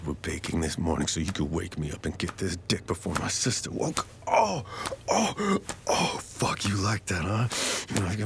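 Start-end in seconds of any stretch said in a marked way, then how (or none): 4.3-6.2 high cries of pleasure; high breathy cries. Acted. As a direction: let the sound break high. Lover crying out in pleasure.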